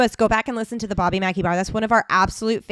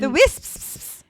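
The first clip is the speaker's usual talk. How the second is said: high Scottish lilt, drawn-out hiss; Scottish accent; 8 semitones higher, 4 dB louder than usual. Pretend. Podcaster savoring a film word, high Scottish accent. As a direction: exaggerate the Scottish "wisps". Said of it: "you gotta do it for the people now".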